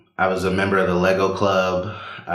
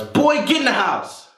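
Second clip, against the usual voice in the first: much higher, sharp alarmed yell; mom yelling. Retold or imitated. Mom jumping out of the car yelling at her son, alarmed. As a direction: snap him inside right now. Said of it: "jumps out the car and yells".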